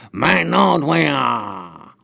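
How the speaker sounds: very slow, drawn-out cat-style call; elongated yowl with a falling tail; demonstrated cat catchphrase. Shown as a demonstration. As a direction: show how the cat says it, stretching every vowel. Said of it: "You just go".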